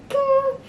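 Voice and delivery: high sung ding; ping like a phone alert; notification ding. Retold or imitated. Imitating phone notification sound.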